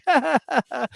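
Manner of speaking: high, put-on cackle; mock gloating cackle. Podcaster imitates banned fan, gloating cackle. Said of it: "he's like".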